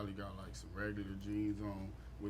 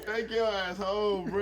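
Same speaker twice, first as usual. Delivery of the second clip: scolding; 8 semitones higher, 8 dB louder, slower than usual. Pretend. Scolding his younger self.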